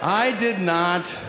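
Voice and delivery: slow, drawn-out mock declaration; emphatic denial. Comic to audience, slow mock-solemn denial. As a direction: spread every word out emphatically.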